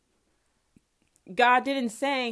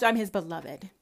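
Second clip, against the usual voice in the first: ordinary talk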